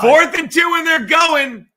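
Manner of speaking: loud excited game call; excited shout. Real, not acted. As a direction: shout it out as the play starts.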